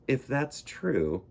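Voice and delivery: ordinary delivery, nothing marked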